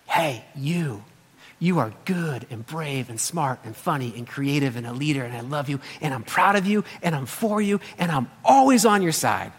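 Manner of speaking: soft, low, loving reassurance; tender bedtime blessing. Retold or imitated. Father blessing each child at bedtime, soft and tender. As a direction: hush it down like a parent at bedside. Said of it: "I look 'em in the eyes and I say".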